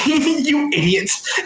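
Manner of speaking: high, drawn-out mock scolding; high-pitched exasperation at a bumbling friend; exasperated partner. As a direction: draw it out high, scolding through amusement.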